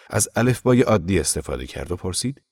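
usual speaking voice

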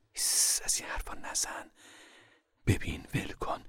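soft whispered shushing; hushing mother. Acted. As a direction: shush the crying child under your breath. Mother hushes crying girl. (نجوای آهستهٔ مادر برای ساکت کردن بچه)

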